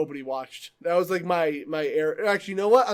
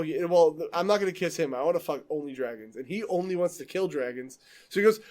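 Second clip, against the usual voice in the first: ordinary talk, nothing marked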